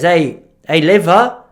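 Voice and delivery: put-on mistaken European accent. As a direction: grandpa voiced in an accidental European accent. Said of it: "He's not even European".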